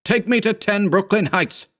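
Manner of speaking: high, hurried order; urgent. A narrator's reading. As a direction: rush the address out.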